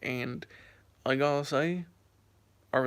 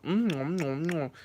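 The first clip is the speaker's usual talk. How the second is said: imitating noisy chewing with closed-mouth hums; mock loud chewing hum. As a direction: mimic someone smacking their food. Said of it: "eating so loud like that".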